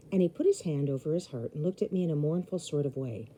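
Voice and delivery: usual speaking voice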